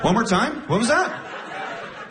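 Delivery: normal talking voice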